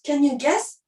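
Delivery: ordinary delivery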